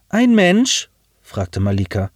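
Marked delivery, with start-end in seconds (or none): 0.1-0.9 sceptical question. A narrator's reading. Malika doubts the pilot.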